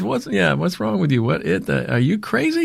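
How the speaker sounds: high-pitched incredulous boss; mimicked Southern boss in disbelief; Charlie. Boss tries talking him out of it, mimicked high. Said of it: "So he says".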